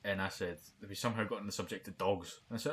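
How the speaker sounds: normal talking voice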